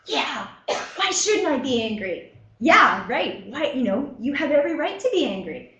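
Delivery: a hammed-up angry comeback; imitating angry patient. Patient snapping back at the therapist, mock angry. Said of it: "they're like".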